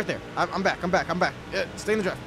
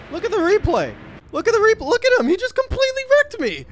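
higher, louder, climbing indignation; indignant rant; 6 dB louder than usual. Real, not acted. Racer showing chat the replay, rising indignant rant. Ranting about wreck.

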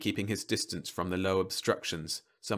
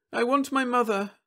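put-on high pleading child; a lost small girl; little girl voice; 5 dB louder than usual.

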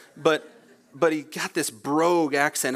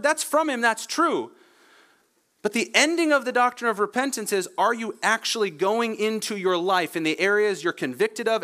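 raised, insistent pulpit voice; impassioned preaching; 8 semitones higher than usual. Real, not acted. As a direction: preach it with urgency, pitched up. Preaches with rising force.

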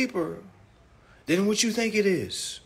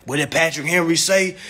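normal talking voice

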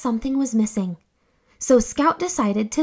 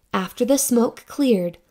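usual speaking voice

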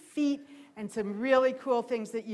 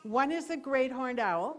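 ordinary talk, nothing marked

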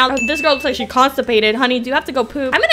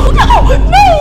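shout; 9 dB louder, slower than usual. Real, not acted. Losing the round.

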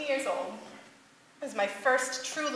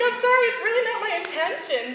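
laughing apology; 11 semitones higher, 5 dB louder, faster than usual. Retold or imitated. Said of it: "Be like".

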